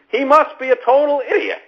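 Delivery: high, incredulous mock outrage; hammed-up sarcastic exclamation; mocking sarcasm. Sarcastic parody of Trump's critics.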